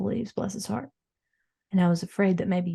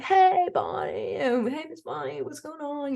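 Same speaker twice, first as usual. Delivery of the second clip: imitating Tanya; 6 semitones higher than usual. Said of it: "She's like".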